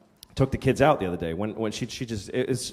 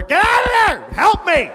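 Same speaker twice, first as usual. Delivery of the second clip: much higher, loud shrieking yell; mock frantic yell; 7 dB louder than usual. Wife banging on the bathroom door, high shriek. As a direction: scream for help through the door. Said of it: "starts banging the window".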